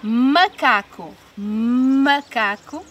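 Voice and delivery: "Macaco" slowly, with a long hummed m; stretched initial sound. Shown as a demonstration. Teacher showing children the first sound, "Macaco" with long m. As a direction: hum the first m of "Macaco" before going on. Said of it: "começa pelo mesmo som".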